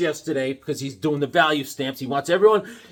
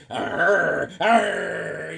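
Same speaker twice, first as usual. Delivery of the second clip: drawn-out cartoon "arr"; mock monster growl; imitated growl; 2 semitones higher than usual. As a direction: growl like a little attacking creature. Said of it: "and he's like".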